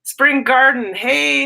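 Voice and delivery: ordinary delivery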